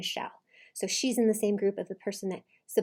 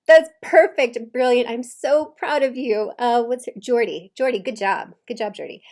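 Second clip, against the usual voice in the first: loud, emphatic cheering; big proud praise, stretched out and punchy; enthusiastic praise; 3 semitones higher, 11 dB louder than usual. Real, not acted. Coach applauding wife for staying calm, loud enthusiastic praise.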